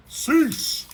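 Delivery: high, loud, commanding cosmic voice; booming god command. Acted. Galactus halting Surfer and Morg's fight, booming command. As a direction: bellow it like a god ending a brawl.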